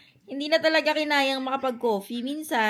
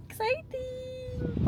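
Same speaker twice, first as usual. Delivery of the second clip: excited; much slower than usual. Real, not acted. Mom hypes upcoming outing.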